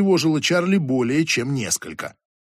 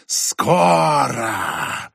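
whistling whisper, rising cry. Acted. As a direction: cry it out in a hissing voice. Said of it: "свистящий женский шёпот".

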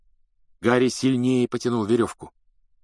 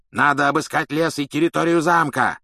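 raised, angry demand; furious growl; 6 semitones higher, 4 dB louder than usual. A narrator's reading. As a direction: snarl out orders in a rage. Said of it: "рычал палач".

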